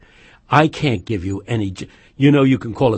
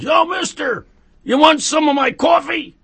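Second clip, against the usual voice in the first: high-pitched imitation of a street character; homeless man hailing. Homeless man calls out, acted.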